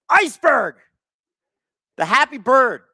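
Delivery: loud, high excited shout; excited reveal. Real, not acted. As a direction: announce the prize like a big surprise. Unveils the giveaway plush bird.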